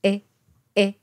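"え" halfway between Japanese i and e; short, lax "え" leaning to i; vowel between i and e. Shown as a demonstration. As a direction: say "え" shaded toward i. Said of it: "日本語のいとえの中間の音".

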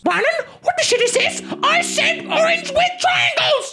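put-on high, slow indignant baby; angry baby voice. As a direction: scold like a bratty toddler. Baby scolds wrong toy.

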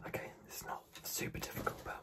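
soft ASMR whisper. Acted. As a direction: breathe the words softly. ASMR guessing game whispering.

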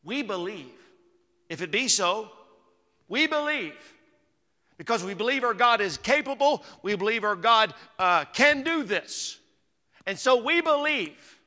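building fervent preaching. Acted. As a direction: build it up until you're ringing out "we believe". Preacher rising to full force.

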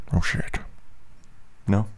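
usual speaking voice

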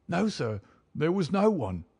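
high, defiant servant voice; stubborn denial. A narrator's reading. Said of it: "set in sullen defiance".

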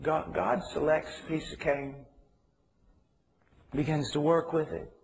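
ordinary delivery

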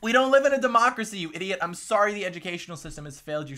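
loud, high-pitched scolding; exasperated retort. Real, not acted. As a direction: shout down a foolish claim. Angry retort to the played clip.

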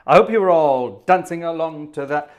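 usual speaking voice

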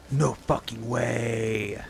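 slow, soft awed disbelief; amazed disbelief. Real, not acted. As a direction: draw it out in awe. Finds rare weapon.

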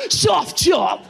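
loud, gasping excited call; thrilled by sun; excited shout. Retold or imitated.

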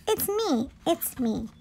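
softly voiced little sheep; sheep character. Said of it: "says the sheep".

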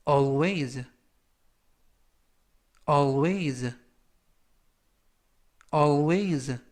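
always, said slowly three times for students to copy; always를 천천히 세 번 또박또박; slow repeat-after-me. Shown as a demonstration. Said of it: "천천히 세 번씩 읽어드리고요". (따라 읽도록 천천히 세 번)

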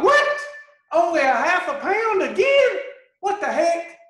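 very high shocked shouted What?, then loud disbelief; outraged shout. Acted. Dieter sees half a pound again, shouting in shock. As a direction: yell at the scale in disbelief.

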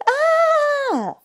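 high, loud scream of fear; frightened yell. Acted. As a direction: shriek in sudden fright. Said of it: "yelled Stubbs, in fear".

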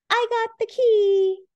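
very high, proud little voice; squeaky character voice. Acted. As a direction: chirp it out proudly. Insulin friend boasts.

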